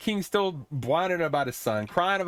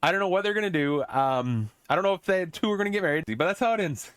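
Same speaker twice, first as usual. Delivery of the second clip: ordinary talk, nothing marked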